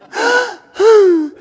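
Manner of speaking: big high theatrical gasp; mock dramatic gasp. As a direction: gasp like a wounded martyr.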